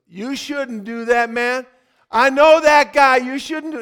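high, loud warning; street guy voiced. Hat guy warns the cop.

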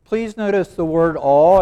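loud, weighty "all" at the end; stress on "all". Shown as a demonstration. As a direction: land hard on "all". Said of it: "I got an emphasis on the word all".

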